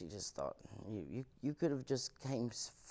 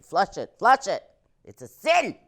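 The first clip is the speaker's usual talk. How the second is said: mock parent; 9 semitones higher, 13 dB louder than usual. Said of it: "my parents were like".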